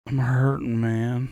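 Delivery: low, soft voice of an injured man; a hint of a man in pain; hurt friend. Quoting injured Charlie to friend, low pained voice. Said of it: "He said".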